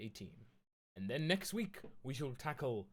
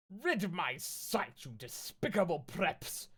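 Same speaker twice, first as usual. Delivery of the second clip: slow, drawn-out sneering villain voice; villain shout; 5 semitones higher, slower than usual. Acted. Snaketail snarling at the girls, mock villain voice. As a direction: spit the insult slowly. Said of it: "he shouted".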